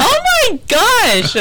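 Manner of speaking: high, slow drawn-out "Oh my gosh"; shocked. Real, not acted. Teased on air by cohost, slow high mock shock. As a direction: stretch it out in mock outrage.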